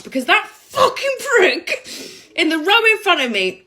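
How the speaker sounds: worked-up comic outrage; exasperated venting. Real, not acted. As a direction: spit out your annoyance at him. Venting about man who complained.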